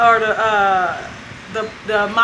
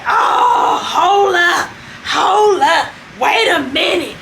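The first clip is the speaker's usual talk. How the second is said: outraged objection; 6 semitones higher, slower than usual. Retold or imitated. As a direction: cry hold up like offended women on a call. The women objecting on the call.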